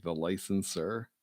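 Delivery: ordinary delivery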